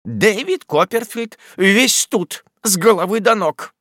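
high, slow scornful exclamation; exclaiming aunt. A narrator's reading. Miss Betsey scorning the dead husband, high exclaim. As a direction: spit out the name in disdain. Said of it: "воскликнула мисс Бетси".

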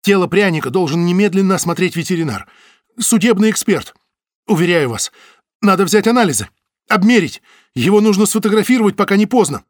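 high, loud, urgent; urgent pleading. Acted. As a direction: rush the plea, pressing.

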